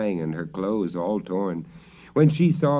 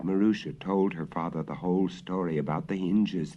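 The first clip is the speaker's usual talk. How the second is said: usual speaking voice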